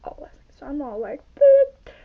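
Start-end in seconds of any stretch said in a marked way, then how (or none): 1.3-1.9 squeaky high loud "boop"; high silly boop. Pretend. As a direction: blurt a shrill silly boop. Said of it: "when I go boop".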